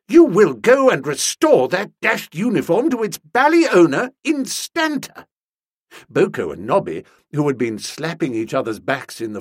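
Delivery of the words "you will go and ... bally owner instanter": loud, high, indignant order; thundering. A narrator's reading. Master orders valet to return the uniform, thundering. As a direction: lay down the law at full volume. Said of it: "to say I thundered".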